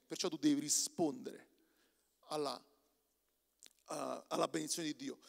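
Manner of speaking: words around a sip; talking between sips; while sipping a drink. Real, not acted. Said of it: "Scusate, sto bevendo tanto oggi".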